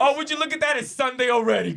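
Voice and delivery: high, loud, laughing fake discovery; hammed-up "oh look" over laughter; mock surprise. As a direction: act out a phony excuse through laughter. Joking impression of a dismissive clerk.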